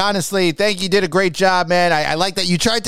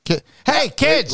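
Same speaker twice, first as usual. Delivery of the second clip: calling out; 7 semitones higher, slower than usual. Pretend. Calling the kids to clap.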